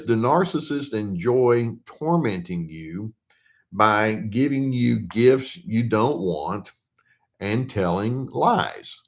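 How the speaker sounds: unhurried, deliberate reading of a viewer's letter; slow read-out question. Acted. Therapist slowly reads a viewer's question to his audience. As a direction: read each phrase out slowly for the class.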